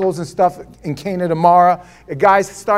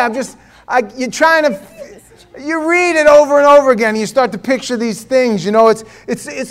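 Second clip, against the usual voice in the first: ordinary talk, nothing marked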